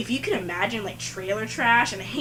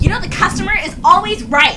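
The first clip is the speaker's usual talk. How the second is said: higher, louder snippy rebuke; retold yelling; 8 semitones higher, 9 dB louder than usual. Coworker scolding the narrator, a higher, louder retold yell. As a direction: snap back like a smug coworker. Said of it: "she's, like, yelling at me".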